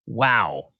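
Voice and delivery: usual speaking voice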